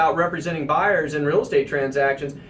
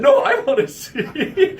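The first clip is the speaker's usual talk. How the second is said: laughing; 8 semitones higher, 4 dB louder, slower than usual. Real, not acted. Realtor laughing at the bizarre house.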